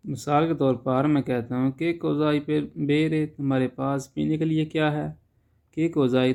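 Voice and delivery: ordinary talk, nothing marked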